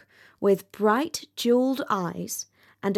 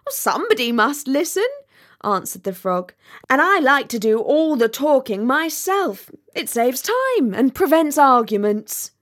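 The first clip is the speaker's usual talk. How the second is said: higher, louder, breezy frog; self-satisfied frog; 5 semitones higher, 5 dB louder than usual. A narrator's reading. Frog answering the rocket's complaint, breezily self-satisfied. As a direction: brush off the complaint loudly and brightly.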